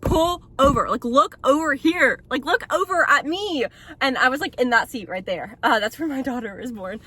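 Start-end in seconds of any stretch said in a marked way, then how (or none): 0.0-3.6 demanding. Retold or imitated. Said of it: "And I'm like".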